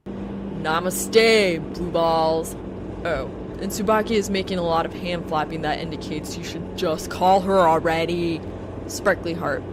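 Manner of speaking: loud, cheeky ribbing; loud teasing. Acted. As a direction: rib him loudly and cheekily. Blake's loud teasing message.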